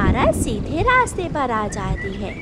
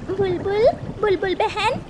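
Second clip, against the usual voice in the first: higher, pleading call at a door; urgent calling; 7 semitones higher than usual. A narrator's reading. Mother bird calls the bulbul at her door, urgent. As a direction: beg her to open up.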